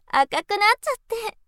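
a high, quick giggle running into the words; teasing laugh. Acted. Big sister teasing her blushing little brother, high quick giggle.

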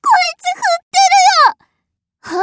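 high, loud words through laughter; laughing while mocking. Mistress laughing at her pet as he obeys, high and loud. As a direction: laugh as you point it out.

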